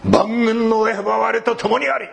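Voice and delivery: high, slow proclaiming call; a retold shout of faith, slow and high; loud declaration. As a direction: proclaim it across the room. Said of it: "その時に安倍さんは大声で言うんです".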